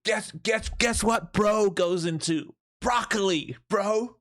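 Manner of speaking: high goofy sing-song bro voice, slow; Chris D'Elia bit. Acted. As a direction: tease the pun in a high silly croon. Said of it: "I've been watching too much Chris D'Elia".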